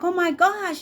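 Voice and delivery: high-pitched panicked oh my gosh; panicked gasp. Retold or imitated. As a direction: squeal it out in alarm. Panicking that the internet is off.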